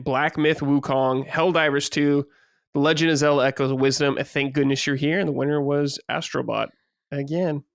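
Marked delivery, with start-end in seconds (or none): none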